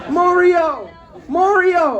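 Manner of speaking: high, loud drawn-out holler; mock holler. Lady keeps heckling him on the train, mock holler. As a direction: holler the name across the car.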